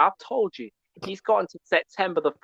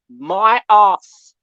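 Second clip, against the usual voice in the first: ordinary talk, nothing marked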